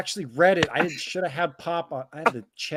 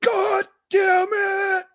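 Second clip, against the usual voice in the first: high, long drawn-out yell; mock yell; 5 dB louder, much slower than usual. Finding the empty ice cream upstairs, drawn-out yell. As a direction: holler it slowly, way up high. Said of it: "I go up there and I yell".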